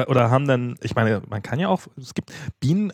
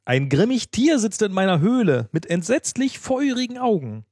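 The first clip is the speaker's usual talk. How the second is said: high, dramatic storybook fox; high-pitched mock alarm; fox's fearful report; 8 semitones higher than usual. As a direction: tell of the monster in a high, theatrical voice. Voices the frightened fox's reply.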